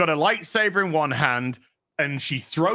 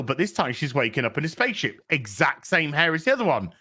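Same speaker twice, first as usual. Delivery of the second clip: ordinary talk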